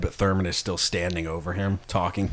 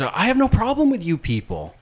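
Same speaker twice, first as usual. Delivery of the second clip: much higher, strained voice for an awkward guy; nervous high voice; 12 semitones higher than usual. Retold or imitated. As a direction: squeak it out as the uneasy man. Retelling the Chepeska movie scene.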